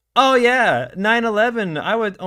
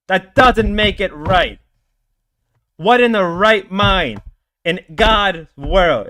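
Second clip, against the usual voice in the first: loud, slow, pointed scolding; real indignant anger; angry lecture; 4 semitones higher, 6 dB louder than usual. Real, not acted. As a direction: hammer the point home. Keeps berating the chatter.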